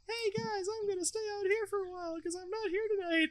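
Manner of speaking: high, far-off call from another room; Daffy calling out. Acted. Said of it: "Daffy actually yells from the other room".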